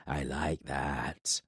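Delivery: low, very slow, heavy voice of a giant warlord; menacing warlord from the shadows. Acted. Giant warlord speaks to Corbin from the shadows, low and slow. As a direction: let each word drop slow and low like a giant's threat. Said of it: "Ildair nodded".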